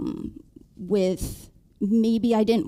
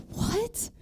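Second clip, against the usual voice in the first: shocked what; 14 semitones higher, 7 dB quieter than usual. Retold or imitated. Shocked reply to husband.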